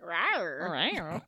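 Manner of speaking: low growly rawr; dinosaur voice. Pretend. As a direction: roar like a big cat. Roar after hearing the Jaguars.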